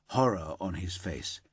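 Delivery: ordinary delivery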